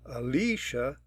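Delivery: Alicia with the middle "LEE" stressed; second-syllable stress. Shown as a demonstration. Teacher demonstrating rule two, Alicia stressed on its second syllable. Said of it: "you'll hear how she pronounces Alicia".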